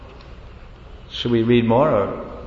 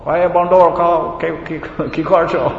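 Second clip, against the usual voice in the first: talking to a monkey; 3 semitones higher than usual. Retold or imitated. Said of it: "Prabhupada was replying in Bandur Bhasha".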